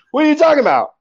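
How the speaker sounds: high-pitched, loud disbelief; baffled and indignant, voice jumping up; incredulous challenge. Retold or imitated. Poet questioning the caller who wants his birthday, incredulous.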